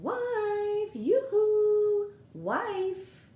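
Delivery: high, loud sing-song call for his wife; farmer calling wife. Acted. Farmer calling his wife from the potato field, high sing-song call. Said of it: "He's saying".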